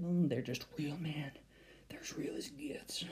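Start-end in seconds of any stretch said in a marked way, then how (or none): 1.9-3.0 soft unvoiced whisper. Real, not acted. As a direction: breathe it as a whisper. Whispered aside on podcast.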